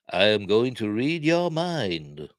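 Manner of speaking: slow, drawn-out telepath voice; hammed-up, stretched-out mystic pronouncement; Professor X. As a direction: intone it slowly like a mock mind reader. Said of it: "Alec Guinness in a wheelchair suddenly becomes Professor X".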